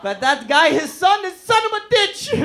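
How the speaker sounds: high, loud cousin voice, laughing through; cousin insisting. Retold or imitated. Cousin defending his word, high laughing voice. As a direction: push it up high like the stubborn cousin. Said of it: "And he goes".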